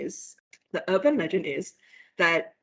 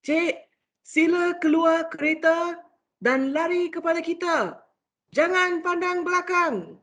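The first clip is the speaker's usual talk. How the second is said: higher, tense police order in Malay; police urging a woman out of the car; urgent policeman; 9 semitones higher than usual. Retold or imitated. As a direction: warn her urgently not to look back. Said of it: "police was like".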